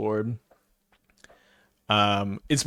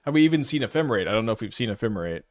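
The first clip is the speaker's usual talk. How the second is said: ordinary delivery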